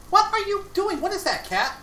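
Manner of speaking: very high, startled question to pet; startled at cat. Real, not acted. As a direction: squeak it out in surprise. Catches cat in food.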